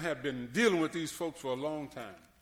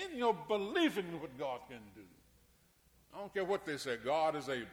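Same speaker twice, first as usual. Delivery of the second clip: ordinary delivery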